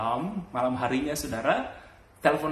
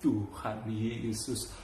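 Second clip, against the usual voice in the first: gentar; 3 semitones lower, 5 dB quieter, much slower than usual. Retold or imitated. Retold reaction to possessed girl's laugh.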